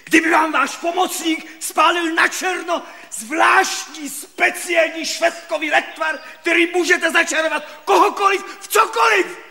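high-pitched, worked-up complaint; indignant outburst. Acted. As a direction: protest shrilly, way up in pitch. Indignant patient justifies his cursing.

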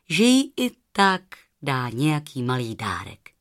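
ordinary delivery, nothing marked

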